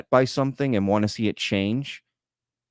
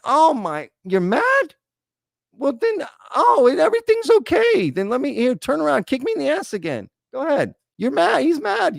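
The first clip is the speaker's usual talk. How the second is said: much higher, sing-song mock concern; mocking sarcastic voice; 13 semitones higher than usual. Mocking angry commenters to his chat, high sarcastic coo. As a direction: coo over the angry guy like he's a fussy baby. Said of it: "we're all just supposed to go".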